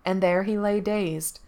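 ordinary delivery

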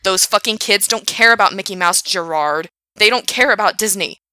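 loud angry rant; railing. Acted. Frank railing at Gerard about the kids, loud rant. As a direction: rail at him, arms up. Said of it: "he railed".

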